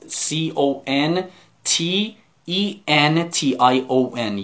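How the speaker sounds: spelling it out for the class at dictation pace; each letter of contention said slowly and separately. Shown as a demonstration. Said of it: "چجور بنویسیم؟".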